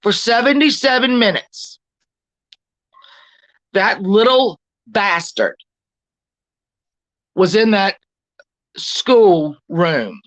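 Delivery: slow, each word weighed: seventy-seven minutes; said slowly. Shown as a demonstration. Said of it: "I'm gonna say this slowly".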